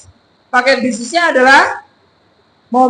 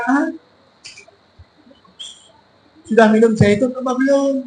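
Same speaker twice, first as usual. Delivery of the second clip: half-hearted mock sales question; unenthusiastic questioning. Shown as a demonstration. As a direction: demonstrate asking without any enthusiasm. (bertanya tanpa semangat)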